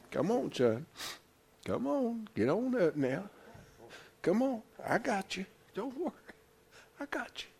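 soft, gentle fatherly encouragement; tender fatherly coaxing. Acted. Father helping his fallen son back up, tender and soft. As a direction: coax a fallen child up, softly.

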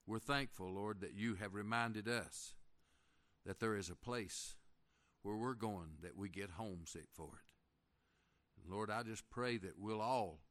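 hushed closing prayer to the Lord. Real, not acted.

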